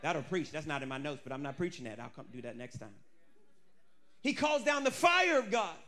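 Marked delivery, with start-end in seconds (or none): none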